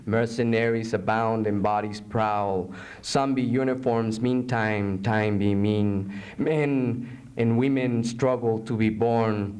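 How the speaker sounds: low, measured poetry reading; solemn poem narration. Acted. Poet reading a poem about torture, low and solemn. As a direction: intone the grim images evenly.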